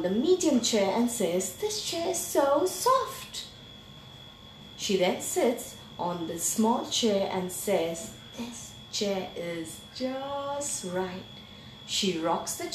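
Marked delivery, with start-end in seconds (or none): 1.6-3.4 higher, lilting up at the end; lightly voiced Goldilocks, pitched up; Goldilocks exclaiming. A narrator's reading. Goldilocks sitting on the medium chair, exclaiming. 8.4-11.3 soft, very slow and savoured; contented Goldilocks. A narrator's reading. Goldilocks settling into the small chair, softly contented.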